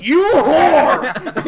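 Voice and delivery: high, loud hammed-up outrage with laughter; mock accusing shout. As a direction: yell a joking accusation, laughing.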